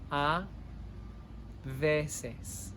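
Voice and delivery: A, pause, then veces; split into two words. Shown as a demonstration. Teacher to class, word by word, slowly. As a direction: say A and veces as separate words with a gap.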